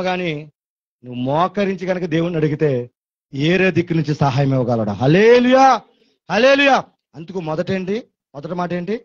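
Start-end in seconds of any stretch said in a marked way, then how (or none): 5.0-6.8 high, loud hallelujah; hallelujah shout. Real, not acted. Preacher calls to the church, loud and high. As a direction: rouse the congregation.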